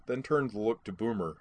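ordinary delivery, nothing marked

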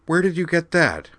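can't believe her eyes; excited disbelief, high and loud; astonished. Acted. Amanda astonished at rare movie.

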